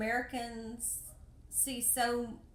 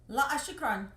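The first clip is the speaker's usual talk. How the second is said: louder, firmer no thank you; insistent refusal; 3 semitones higher, 8 dB louder than usual. Retold or imitated. To a begging girl, firm loud refusal. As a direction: push the street girl off firmly. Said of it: "and I go".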